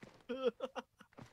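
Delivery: high cartoon chuckle; high-pitched goofball laugh; Goofy laugh. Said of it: "say it in Goofy's voice".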